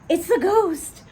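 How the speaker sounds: high, loud frightened cry; scream. A narrator's reading. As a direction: shriek it in fright. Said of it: "they all scream".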